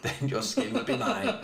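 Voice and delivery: slow, high mock-villain with a laugh; scheming wolf. Captive wolf plotting against humans, hammed-up villainy. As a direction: savour the threat slowly, laughing through it.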